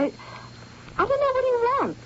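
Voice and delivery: high, slow and halting, near breaking; distressed. Acted. Yvonne confiding to Max about George, high and distressed. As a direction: let the pitch jump up as it spills out.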